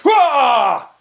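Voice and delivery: loud, high shouted lion roar. Acted. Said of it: "The lion leaps".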